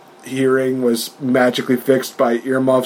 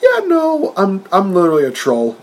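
mock Tyson; 7 semitones higher than usual. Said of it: "Mike Tyson's just like".

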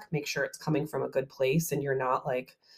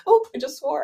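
laughing through the words; giggling as she talks; 11 semitones higher, 4 dB louder than usual. Real, not acted. Laughs at own swearing.

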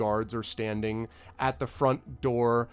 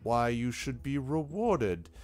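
very slow, drawn-out lordly speech; measured lord, sceptical; much slower than usual. Acted. Lord steers the boaster toward the guards, slow and deliberate. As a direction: choose each word slowly, politely sending him away. Game master voices Lord Roaringhorn.

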